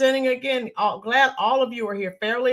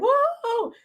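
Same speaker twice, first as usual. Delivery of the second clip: high squealed whoa without extra volume; a mild mock yell, pitched way up; retold excited yell. Screaming at the crowd, replayed as a high mild whoop. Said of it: "we were screaming".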